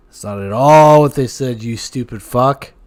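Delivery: hammed-up angry punchline; loud mock outburst.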